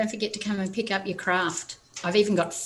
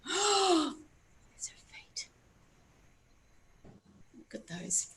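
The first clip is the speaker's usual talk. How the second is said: slow breathy gasped whisper; awed whisper to children; gasping whisper; 8 semitones higher, 7 dB quieter, slower than usual. A narrator's reading. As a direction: gasp at the flying chicken. Gasp of wonder.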